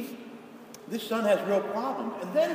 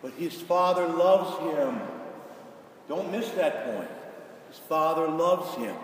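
normal talking voice

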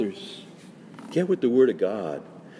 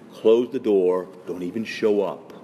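ordinary delivery

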